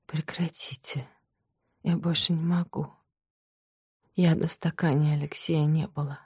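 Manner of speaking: soft, exhausted, broken; drained of all strength; deathly tired. Acted. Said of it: "смертельно уставшим голосом произнесла Марья".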